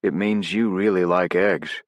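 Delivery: dry, slow deadpan. Acted. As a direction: say it dead straight, slowly. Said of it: "with deadpan delivery".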